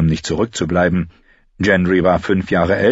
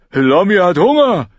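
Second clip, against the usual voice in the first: put-on high, louder whine of a hungry boy; whining; 12 semitones higher, 6 dB louder than usual. A narrator's reading. Heiße Pastete whines to Gendry about hunger. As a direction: whimper it like a complaining child. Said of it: "jammerte Heiße Pastete".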